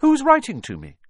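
high, loud, urgent question; insistent demand. A narrator's reading. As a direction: press your uncle for an answer. Boy confronts uncle over stolen letter.

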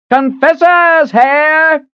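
high, loud, slow hawker's bellow. A narrator's reading. Street hawker bellows to passersby, loud and high. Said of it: "the man bellowed".

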